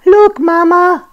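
put-on high excited kid voice; little child voice. A narrator's reading. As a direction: call out like a small child. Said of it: "said Roo".

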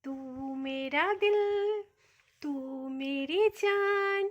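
slow, loud, sing-song cooing. Real, not acted. Mother crooning endearments to her baby, slow and loud.

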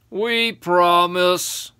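slow, loud, drawn-out pledge; giants promising. Acted. Three giants promising the boy, over-earnest. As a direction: chant it like giants making a promise.

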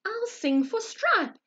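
high, slow eager thought; light up with the plan; excited. Acted. Sheep excited to sing for badger, high and eager. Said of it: "thought Samuel excitedly".

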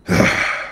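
long voiceless exhale; weary sigh. Real, not acted. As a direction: let out a heavy sigh before going on. Exasperated by team's record.

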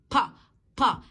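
"با" as a puffed pa, lips pressed; heavy p. Shown as a demonstration. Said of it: "ضم شفايفك وأنت بتنطقها". ("با" بباء تقيلة، الشفايف مضمومة)

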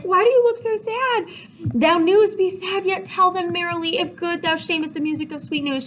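high, loud, fretful pleading; worried Juliet. Acted. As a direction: demand answers in an anxious high voice. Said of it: "And Juliet's worried".

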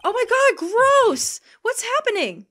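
high, loud revolted outcry; shrieking, grossed-out alarm; horrified disgust. Real, not acted. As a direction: yell out in shocked revulsion. Shocked by gross game scene.

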